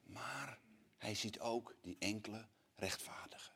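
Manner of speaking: slow, breathless whisper; slow down and whisper it like a secret. Acted. Preacher speaks of the righteous few, slow whisper. Tender point in the sermon.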